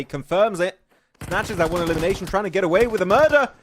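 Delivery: rising esports call, building to loud high peaks; live play-by-play. Acted. Caster calling a lone player's killing spree live. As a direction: drive the commentary as the fight heats up.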